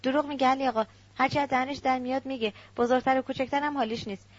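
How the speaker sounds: ordinary delivery, nothing marked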